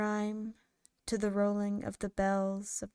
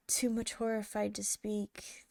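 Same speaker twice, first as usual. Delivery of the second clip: ordinary delivery, nothing marked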